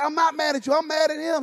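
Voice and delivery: high-pitched insistence; husband denying he is angry at his wife, high and pressed; defensive protest. Retold or imitated. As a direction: protest your innocence, pitched well up. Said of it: "And I'm like".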